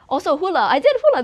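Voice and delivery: bursting with excitement; excited. Real, not acted. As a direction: blurt it out, thrilled. Excited about doing hula.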